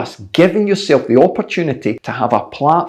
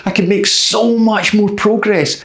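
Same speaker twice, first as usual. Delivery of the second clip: higher, whining voice full of excuses; whiny excuse-maker; 6 semitones higher than usual. Retold or imitated. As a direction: moan like someone dodging training. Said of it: "I hear this a lot from people".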